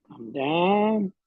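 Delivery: normal talking voice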